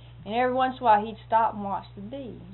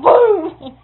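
a loud, high burst of "Boom!"; shouted boom; 10 semitones higher, 19 dB louder than usual. Retold or imitated. Telling how he shot the dog, loud mock boom. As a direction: punch out the gunshot noise with a laugh. Imitating a gunshot.

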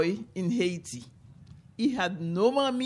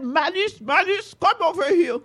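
much higher, loud, urgent warning; Buki calling out; 7 semitones higher, 6 dB louder than usual. Acted. Buki warning his wife Malice about the calf, high rising alarm. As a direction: call her out, then build to a fearful shout. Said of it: "Buki called inside".

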